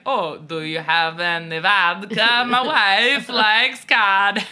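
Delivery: slow, hammed-up Russian accent. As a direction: play a bumbling agent faking Russian. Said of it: "They're like".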